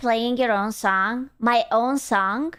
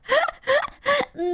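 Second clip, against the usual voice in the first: high laugh; 13 semitones higher than usual. Real, not acted. Laughing at pronunciation.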